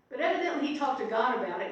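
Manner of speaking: ordinary talk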